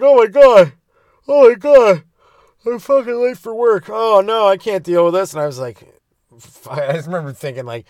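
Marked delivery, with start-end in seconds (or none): 0.0-5.3 high, loud panicky voice of a man late for work; panicked driver. Retold or imitated. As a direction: shriek like the world is ending over being late. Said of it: "He gets out, he's like".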